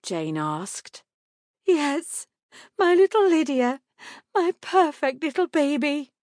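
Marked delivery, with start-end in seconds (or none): 1.6-6.1 very high, loud, emotional cooing; tearful mother. A narrator's reading. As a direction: dote on the newborn through tears. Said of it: "Still crying".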